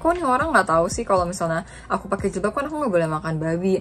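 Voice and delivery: ordinary talk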